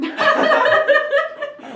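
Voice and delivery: laughing squeal. Real, not acted.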